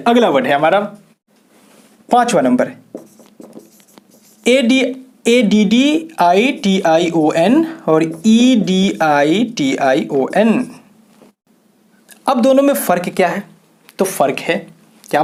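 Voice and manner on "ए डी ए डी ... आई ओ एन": slow spelled-out letters. Shown as a demonstration. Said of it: "spelling letters slowly".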